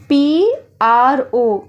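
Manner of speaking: letters पी आर ओ spaced out slowly; slow spelling. Shown as a demonstration. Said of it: "पी आर ओ को pro पढ़ा जाएगा".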